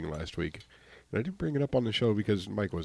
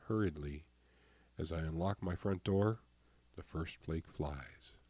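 poem reading; 5 dB quieter than usual. Acted. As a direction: read it like a poem. Reads own poem.

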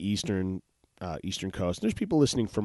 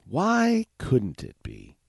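drawn-out, wondering repeat; slow musing echo; slower rhetorical ask; 5 semitones higher, 4 dB louder, much slower than usual. Shown as a demonstration. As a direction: stretch the question longer.

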